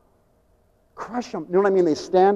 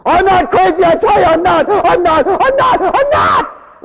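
high, loud desperate pleading; mock patient yelling he's not crazy; frantic protest; 12 semitones higher, 8 dB louder, slower than usual. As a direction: insist you're sane, voice cracking upward.

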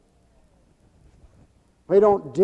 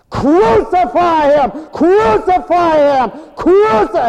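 high, loud mob chant; hammed-up crowd yelling crucify; mob shout; 12 semitones higher, 10 dB louder, slower than usual. Preacher voices the mob.

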